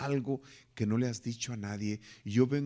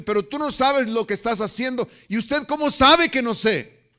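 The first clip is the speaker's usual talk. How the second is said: loud, high yell of a furious father; 14 dB louder than usual. Retold or imitated. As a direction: shout the no at the son. Father refuses son at office. (el padre le grita furioso al hijo)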